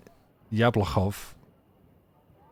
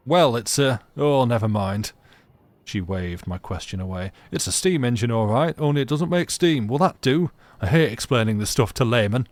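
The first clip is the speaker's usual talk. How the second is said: louder, impatient Yorkshire grumble; irritable Yorkshire accent; 2 semitones higher, 5 dB louder than usual. Acted. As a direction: brush off the layman in a Yorkshire voice. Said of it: "in a thick Yorkshire accent".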